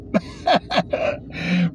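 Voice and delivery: short breathy chuckle; breathy laugh. Real, not acted. Laughter after mockery.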